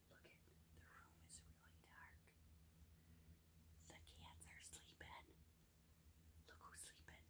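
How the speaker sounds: very soft whisper; bedtime whisper. Shown as a demonstration. Teacher whispers as the characters fall asleep. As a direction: whisper so nobody wakes up. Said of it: "everyone sleeping, whispering".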